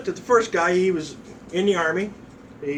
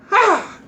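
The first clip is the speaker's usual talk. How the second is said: loud, high "ha"; sudden high exclamation; pained yelp; 13 semitones higher, 6 dB louder than usual. Retold or imitated. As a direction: cry out as if struck. Recruit's yelp when hit.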